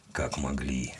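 low, soft grumble; disgruntled, under the breath; grumbling. A narrator's reading. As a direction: mutter it low in disapproval. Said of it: "проворчал я".

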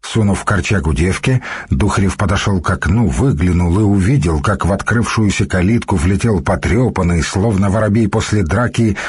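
usual speaking voice

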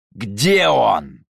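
loud angry growl. Acted. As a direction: snarl the question. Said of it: "рыкнул Марк".

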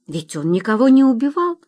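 uncertain objection. A narrator's reading. Wife defending her husband to the military doctor, uncertain objection. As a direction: object as if half asking. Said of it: "неуверенно возразила Тася".

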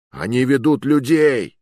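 громкий тревожный возглас; выкрикни, указывая вдаль; восклицание. A narrator's reading. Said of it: "воскликнул". (стражник громко восклицает)

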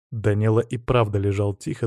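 usual speaking voice

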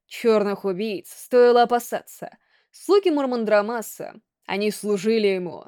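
ordinary delivery, nothing marked

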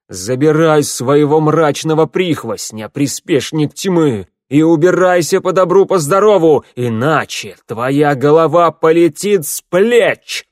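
loud, slow threatening roar; booming warrior king. A narrator's reading. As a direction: threaten with a beheading. (грозный рёв короля)

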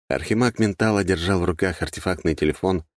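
ordinary delivery

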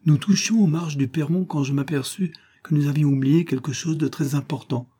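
plain reading voice

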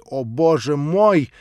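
slow, loud despairing cry; desperate cry. A narrator's reading. Natasha crying out in despair at the prince's insult. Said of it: "отчаянное восклицание Наташи".

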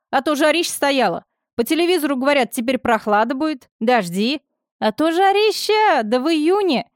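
chatty village orderly. A narrator's reading. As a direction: babble on like a village gossip. Orderly chatters while mopping.

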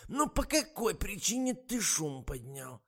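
высокий, медленный старушечий голос; ласково уговаривает, как подслеповатая старушка; болтливая старуха. A narrator's reading. (высокий тягучий голос старой актрисы)

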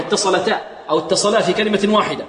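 ordinary talk

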